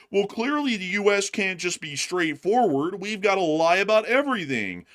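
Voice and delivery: a hint of a smug defender; mock pundit. People defending the government in a light mock voice. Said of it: "some people will sit there and say".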